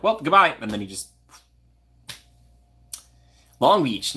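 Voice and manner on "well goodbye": mock goodbye. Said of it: "you're like".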